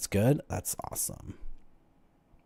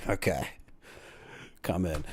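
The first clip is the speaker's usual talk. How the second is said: gruff guard; 7 semitones lower, 11 dB quieter than usual. Retold or imitated. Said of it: "And they'd be like".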